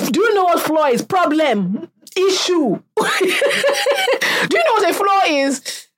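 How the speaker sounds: incredulous rant; exasperated. Real, not acted. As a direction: drive home the point, fed up. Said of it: "exasperated rant".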